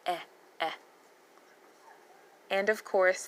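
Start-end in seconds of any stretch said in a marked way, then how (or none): 0.1-0.7 clipped-off "Eh, eh", with a gap between; short E sound. Shown as a demonstration. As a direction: say "Eh, eh" as two brief vowels. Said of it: "E is the short E".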